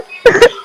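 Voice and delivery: high-pitched sob; crying. Acted. Girl facing a forced marriage breaks down before her classmate, crying. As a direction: burst into sobs after speaking. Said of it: "Stop crying".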